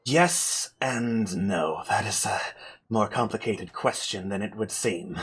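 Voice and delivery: low, soft, measured answer; weary spellcaster. Acted. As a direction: answer guardedly, drained from the casting. Game master plays drained Amber.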